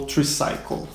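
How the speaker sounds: tricycle said tri-SAI-cle, Portuguese-style; tri-cycle contrast. Shown as a demonstration. Teacher sets a Portuguese-style tricycle against the English one. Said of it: "Qual das duas?".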